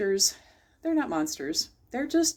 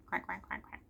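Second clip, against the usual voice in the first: little duck sound; 14 dB quieter than usual. Shown as a demonstration. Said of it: "we'll make a little duck sound".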